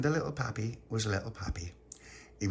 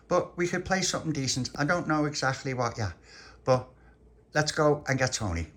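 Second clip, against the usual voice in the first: put-on higher, keen child's voice; keen little boy; 5 semitones higher, 4 dB louder than usual. Little pappy planning play with Pauline, keen little boy. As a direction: suggest it like an eager little boy.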